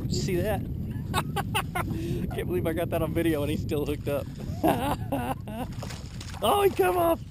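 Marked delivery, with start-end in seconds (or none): 0.7-2.3 high delighted burst of laughter; giddy high laugh; excited laugh. Real, not acted. Fish hooked on video. 4.3-6.4 high delighted burst of laughter; giddy high laugh; excited laugh. Real, not acted. Fish still hooked. 6.4-7.2 high, loud dismayed cry; sudden high groan of loss; surprised yelp. Real, not acted. Fish escapes suddenly.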